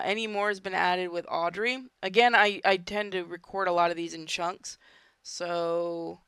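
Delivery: usual speaking voice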